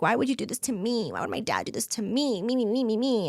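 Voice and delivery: a light send-up of a self-pitying me-me-me; playfully whining about being the victim; mock self-pity. Mocks her younger self-centered self.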